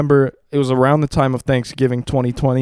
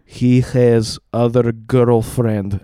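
put-on slow, heavy Russian accent; slow Russian-accented dad impression; 3 semitones lower, much slower than usual. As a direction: imitate dad, dragging each word in Russian-accented English. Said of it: "in his Russian accent".

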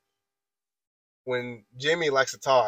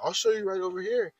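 higher-pitched mock agitation, no louder; retold raised voice; 8 semitones higher than usual. Retelling a coworker offering to show him, mildly raised voice. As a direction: imitate him getting worked up. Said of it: "he's getting progressively louder".

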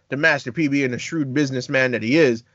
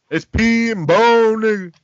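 slow, higher rap ad-lib voice; mimicking Master P; 5 semitones higher, 5 dB louder, slower than usual. Friend quoting a rapper's ad-lib on a podcast, mimicking the drawl.

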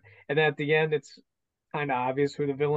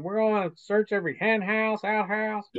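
imitating Tommy Lee Jones; 6 semitones higher than usual. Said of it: "he's going".

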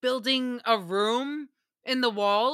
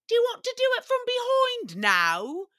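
much higher, louder mock British ask; exaggerated high British voice; British accent; 13 semitones higher, 4 dB louder than usual. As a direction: ham up the boyfriend's prim question. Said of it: "he would always ask".

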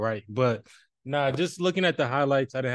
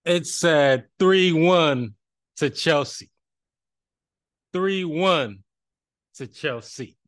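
loud, drawn-out gloating scoreline; bassy, happy boasting; 4 semitones higher, 6 dB louder, much slower than usual. Real, not acted. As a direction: crow the score proudly, stretching it out with pauses. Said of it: "he got a lot of bass in his voice".

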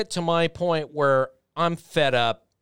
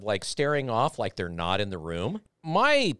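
ordinary delivery

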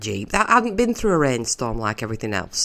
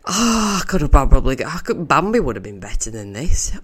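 ordinary talk

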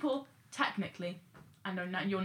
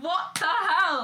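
loud, disbelieving what the hell; exasperated outburst at nonsense; incredulous raised voice; baffled; 7 semitones higher, 8 dB louder, slower than usual. Retold or imitated. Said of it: "and I'm like".

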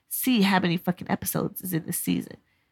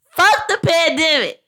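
louder, slower shout with a laugh; 8 semitones higher, 5 dB louder, slower than usual. Shown as a demonstration. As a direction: belt it out over the noise, laughing. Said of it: "Thank you, ice machine, for backing me up".

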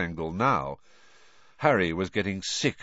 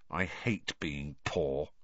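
gloomy; 5 semitones lower, 7 dB quieter, slower than usual. A narrator's reading. Ron glaring, gloomy.

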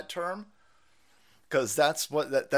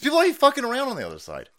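urgent fast rant; 9 semitones higher, 6 dB louder, faster than usual. Real, not acted. Urgent warning about Google.

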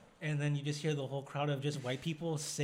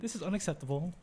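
higher-pitched put-on English accent; playful British indignation; mock English accent; 4 semitones higher than usual. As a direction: protest in a comic English accent. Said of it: "but in English accent".

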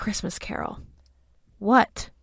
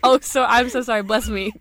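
ordinary delivery

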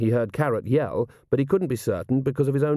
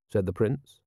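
plain reading voice

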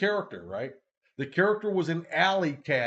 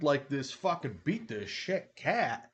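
ordinary talk

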